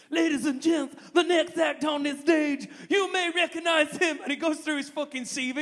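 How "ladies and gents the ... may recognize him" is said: hype compère. Retold or imitated. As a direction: hype the crowd like a big American compère. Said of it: "his introduction to Bernie Mac".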